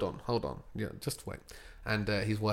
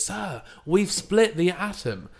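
proud scientist; 8 semitones higher, 4 dB louder, slower than usual. Retold or imitated. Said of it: "He goes".

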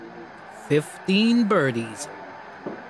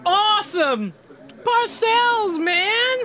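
excited cheer; 14 semitones higher, much slower than usual. Acted. Cheering the football play on TV, high excited whoop.

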